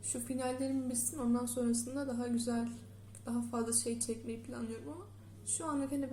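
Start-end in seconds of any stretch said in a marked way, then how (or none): none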